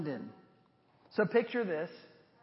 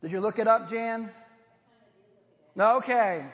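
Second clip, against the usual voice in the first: ordinary talk, nothing marked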